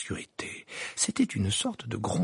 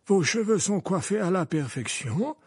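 gallant; 8 semitones higher than usual. Acted. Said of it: "assura galamment".

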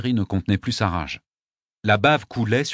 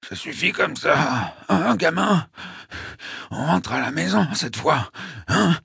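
weak, strained gasps between phrases; laboured breathing; 6 semitones higher, slower than usual. A narrator's reading. Dying Mike wanting to go home, breathless. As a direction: gasp it out, higher and effortful.

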